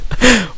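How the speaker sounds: quick high giggle; short laugh. Real, not acted. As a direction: burst out laughing briefly. Laughing amid banter.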